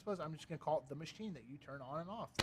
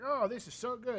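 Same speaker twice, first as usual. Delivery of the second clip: higher, louder mock rave from a TikTok seller; gushing seller; 10 semitones higher, 11 dB louder than usual. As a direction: ham up a cheesy product pitch. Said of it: "they buy cheap stuff".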